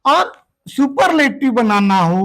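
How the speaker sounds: ordinary delivery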